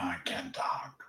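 whispered through tears; barely getting words out while crying; choked up. Real, not acted. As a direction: voice gone from crying. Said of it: "I'm being all choked up now".

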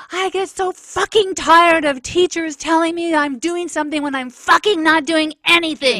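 loud, high furious yell at the teacher; angry yell. Retold or imitated. Student yelling at his teacher in class, furious shout. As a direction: scream it at her in front of the class. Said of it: "of course, he yelled it".

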